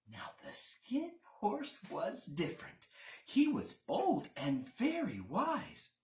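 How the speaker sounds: ordinary delivery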